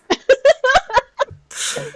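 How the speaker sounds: high, loud shrieking cackle; high shrieking laugh. Real, not acted. Big laugh.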